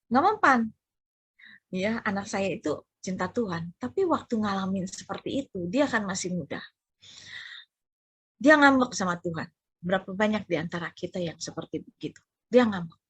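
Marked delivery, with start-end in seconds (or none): none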